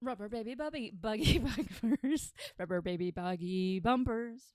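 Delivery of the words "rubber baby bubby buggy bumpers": laughing stumble. Real, not acted.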